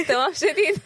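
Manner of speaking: amused, laughing delivery. Real, not acted. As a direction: say it while laughing. Laughing over Ibrahim's fine greeting.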